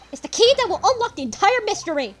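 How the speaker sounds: dramatic revelation. Acted. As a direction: proclaim the big discovery. Voicing dramatic game dialogue.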